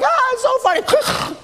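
very high, giggly squeal; imitated laughing child. Preacher imitates his snorting child mid-laugh, high squeal. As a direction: mimic a kid overcome with laughter. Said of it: "they're like".

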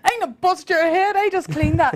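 loud, high mock threat from the big brother; angry threat. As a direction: belt it out like an angry older boy. Older brother threatening, retold.

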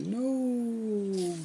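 long, high drawn-out no; mock-agonized storybook howl; wailed protest. A narrator's reading. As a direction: wail it high and stretch it. Reads out Andrew's protest, drawn out.

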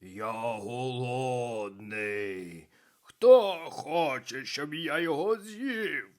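booming hungry ogre; ogre shouting. A narrator's reading. Людожер вигукує до натовпу, гучний рев. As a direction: bellow it like a starving ogre. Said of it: "вигукує людожер".

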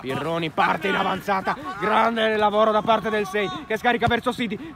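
ordinary talk, nothing marked

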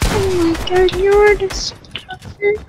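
put-on high, soft, slow disgusted girl; drawn-out girly disgust. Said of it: "He's trying to act like a girl".

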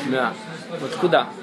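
ordinary delivery

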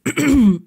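a loud, nervous throat clear. A narrator's reading. Blaze clearing his throat, flustered by Neville. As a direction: clear the throat before speaking. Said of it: "er räusperte sich".

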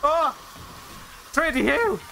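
high-pitched cries of surprise; startled outcry. Real, not acted. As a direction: call out high, caught off guard. Startled outcry during play.

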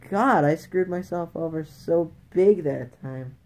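ordinary delivery

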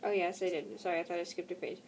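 ordinary delivery

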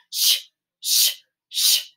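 breathy steam engine hiss; train hiss. A narrator's reading. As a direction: hiss like a departing locomotive. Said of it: "the engine started saying".

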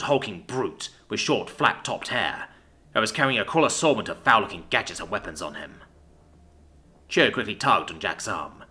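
plain reading voice

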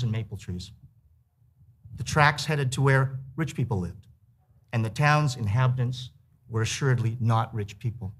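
reading aloud. A narrator's reading. Author reads his essay aloud.

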